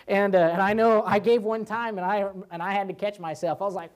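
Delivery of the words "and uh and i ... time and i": usual speaking voice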